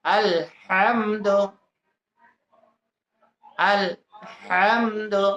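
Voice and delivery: "আলহামদু" very slowly, with a breathy throat h; throat ha drill. Shown as a demonstration. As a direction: split "আলহামদু" after আল, then draw out হাম from mid-throat. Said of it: "আঙ্গুল দিয়ে উচ্চারণ করেন". (টেনে টেনে, গলার মাঝখান থেকে হা দিয়ে "আলহামদু")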